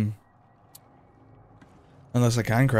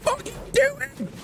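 very high frantic screech; losing it completely, shrieking; screaming fit; 4 dB quieter, much slower than usual. Real, not acted. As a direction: tail end of a real panic scream. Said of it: "that little screaming fit".

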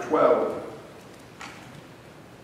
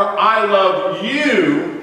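"you" slowed and punched loud; single out "you" above all others; stress on YOU; 4 semitones higher, 5 dB louder, much slower than usual. Shown as a demonstration. Preacher telling one listener they alone are loved. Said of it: "is stressing it's not the other people I love".

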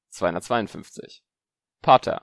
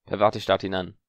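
ordinary delivery, nothing marked

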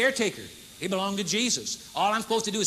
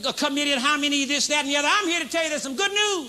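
rally the congregation; fiery sermon delivery; preaching at full force; 4 semitones higher than usual. Real, not acted. Preaching good news.

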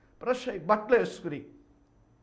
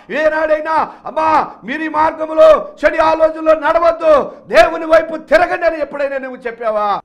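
loud, higher, driving sermon climax; preaching at full force; 11 semitones higher, 14 dB louder than usual. Acted. Preacher confronting his flock, loud and pleading. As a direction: hammer the question at the congregation.

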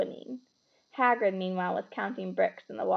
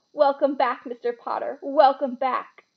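emotional welcome; 4 semitones higher, 7 dB louder than usual. A narrator's reading. Bartender greets Harry in tears.

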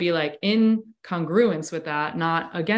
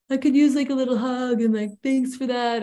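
retold shy request in a higher voice; 5 semitones higher than usual. Asking the coach for a little hug, shy. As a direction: imitate a bashful, tentative ask, pitched up. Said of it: "all, like, shy".

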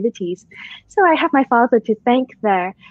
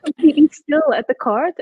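laughing; 5 semitones higher than usual. Real, not acted. Laughing while recalling badminton game.

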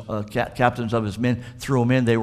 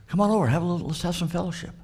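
higher, friendly calling voice; cheerful invitation; 8 semitones higher, faster than usual. Acted. Preacher voicing the Lord inviting the boys to fellowship, higher and friendly. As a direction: call them over warmly.

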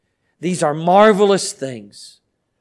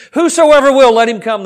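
emphatic scripture quote; 7 semitones higher, 6 dB louder than usual. Acted.

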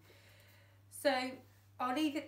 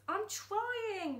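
much higher, pleading; strained reply; 11 semitones higher than usual. Acted. Dad answering Fingers while stuck, strained. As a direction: protest that you're trying. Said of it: "replied Dad".

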